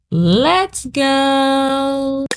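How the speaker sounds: ordinary delivery, nothing marked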